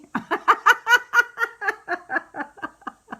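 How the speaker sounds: high, loud laughing fit; burst of laughter. Real, not acted. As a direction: crack up out loud. Vicky's comment tickles her.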